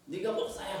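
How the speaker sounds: high scornful jab; taunting. Retold or imitated. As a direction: sneer it at him. Accuser's taunt retold.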